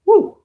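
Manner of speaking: a sudden high, loud hype whoop; excited whoop. Real, not acted. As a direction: let out a big celebratory "Woo!". Hyping up the stream.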